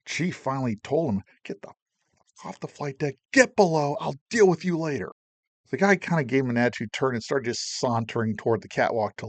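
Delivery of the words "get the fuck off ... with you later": chief's angry order. Retold or imitated. Chief ordering sailor off deck.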